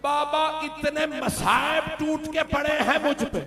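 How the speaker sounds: loud, high wailing cry, slowed at the start; weeping lament. Acted. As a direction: wail the Arabic lines as if sobbing. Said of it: "बीबी ने रो के कहा".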